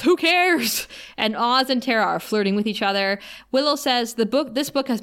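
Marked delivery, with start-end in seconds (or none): 0.0-0.9 a much higher, louder heckle; loud mocking shout. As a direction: bark it out from the back of the class. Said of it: "And Xander shouts".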